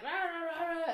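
loud, high wordless angry babble; mock ranting. Acting out her complaint to the swimming instructor, ranting. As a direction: rant in nonsense syllables, hammed up.

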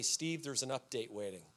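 low, soft device-like notification voice; his soul, like a phone, quietly telling Steve to reboot; soul's update notice. Pretend. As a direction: read it like a quiet system alert. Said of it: "pop up on my screen to say".

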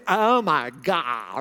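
put-on slow, drawn-out party-girl whine; mock a college girl, dragging every word; exaggerated ditzy girl impression; annoying college girls. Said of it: "they're just talking like this".

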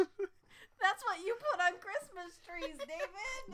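high, laughing protest; giggling objection to a friend. Real, not acted.

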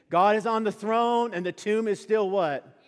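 loud, high, rousing preaching; preaching at full force. Acted. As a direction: lift the congregation to the climax. Repeats the climax line.

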